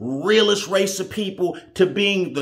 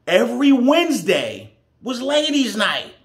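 emphatic announcement; 8 semitones higher, 5 dB louder than usual. Real, not acted. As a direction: hit it loud and high for the listener. Storyteller stresses the key detail.

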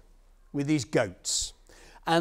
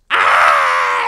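very loud storybook scream; farmer's scream; 10 semitones lower, 19 dB louder than usual. Acted. Farmer hears a goat speak, loud scream. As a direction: scream it at full volume. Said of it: "screamed the farmer".

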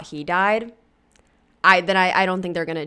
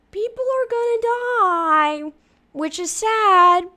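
[name]; 11 semitones higher, 3 dB louder than usual. Retold or imitated. Imitating a celebrity's remark about dying, high-pitched mimicry. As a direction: draw it out high, lifting at the end. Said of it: "as [name] said".

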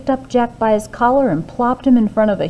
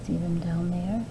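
soft aside; 2 semitones lower, 10 dB quieter than usual. A narrator's reading. Aside pointing at picture.

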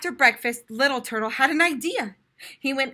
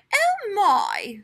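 higher, gentle surprise; surprised teacher; 9 semitones higher, 3 dB louder than usual. A narrator's reading. Teacher seeing penguin student, higher mild surprise. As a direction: react with mild astonishment. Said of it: "said Miss Dog".

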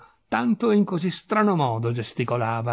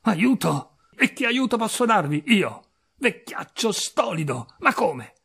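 higher-pitched, exasperated rebuke; indignant, raised voice; scolding cry; 6 semitones higher than usual. A narrator's reading. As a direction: scold the stupid old man. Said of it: "gridò al vecchio".

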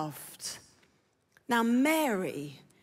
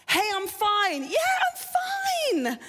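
high, drawn-out insistence of being fine; over-cheerful fine; 7 semitones higher, slower than usual. Acted. Preacher acting someone hiding brokenness, falsely cheerful. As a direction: put on a too-bright, stretched-out cover-up.

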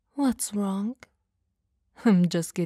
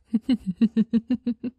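amused laugh; 3 semitones higher, 6 dB louder than usual. Acted. Amused at his screaming.

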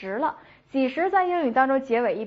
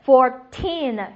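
put-on "fourteen" with "teen" long and loud; stress on TEEN; 8 dB louder than usual. Shown as a demonstration. Teacher drilling teen numbers, stress on the last syllable. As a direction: slowly, push hard on the end of "fourteen". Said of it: "往后使劲儿啊".

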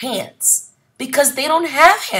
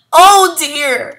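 higher, louder wail of "Oh dear"; mother wailing "Oh dear" over the lost house; sobbing; 7 semitones higher, 7 dB louder than usual. Acted. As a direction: cry out "Oh dear" in distress. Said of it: "she sobbed".

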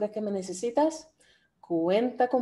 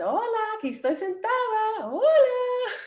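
much higher, cute cartoon bottle voice; a bubbly bottle of Coke greeting everyone; talking Coca-Cola; 13 semitones higher than usual. Teacher voicing the Coca-Cola bottle saying hello, high and cute. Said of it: "La Coca-Cola dice".

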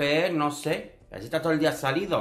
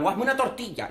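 high, mock-commanding order; bossy dominatrix; 8 semitones higher than usual. Dominatrix ordering her client, mock bossy and high. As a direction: boss him around like a dominatrix. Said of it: "en plan Nines".

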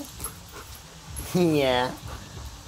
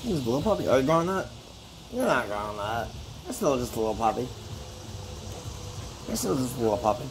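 talking to a puppy; 8 dB quieter, faster than usual. Real, not acted. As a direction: coo gently, like to a little pet.

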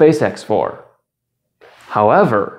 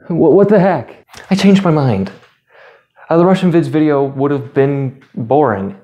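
usual speaking voice

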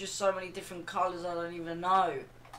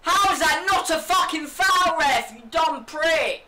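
high furious yell at the ref; raging gamer; 9 semitones higher, 5 dB louder than usual. Real, not acted. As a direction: scream at the ref. Raging at referee.

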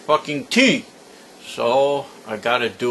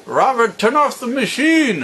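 a much higher shout to the next room; calling out; 10 semitones higher, 3 dB louder than usual. Real, not acted. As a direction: yell for Robert to hear you. Calling to grandson in other room.

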